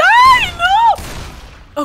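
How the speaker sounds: chillido muy agudo y fuerte; grito de susto. Acted. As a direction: chilla asustado. Susto en el juego. (Whis chilla de susto, muy agudo y fuerte)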